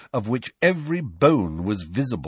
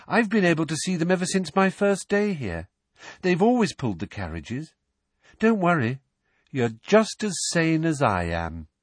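put-on higher, lightly dreamy girl; serene Luna; 7 semitones higher than usual. A narrator's reading. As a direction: calmly reassure Harry.

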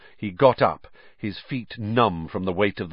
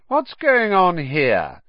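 slow, cold and high; cold drawl; 9 semitones higher, 5 dB louder, slower than usual. A narrator's reading. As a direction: drawl it with contempt. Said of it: "the cold, drawling voice of Draco Malfoy".

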